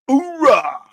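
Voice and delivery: very high, loud cheer of "Hoorah!". Real, not acted. Trader cheering a favourite pair, whooping. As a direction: throw up a triumphant whoop.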